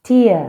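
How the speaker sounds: "Tea" called out loud and high; model word. Shown as a demonstration. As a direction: announce "Tea" clearly. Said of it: "Tea that we drink".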